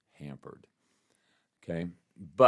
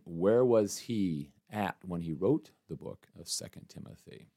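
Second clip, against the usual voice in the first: ordinary talk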